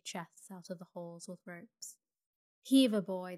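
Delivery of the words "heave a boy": much louder work call; sailors' shout. A narrator's reading. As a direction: call out like sailors hauling cargo. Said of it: "they shouted".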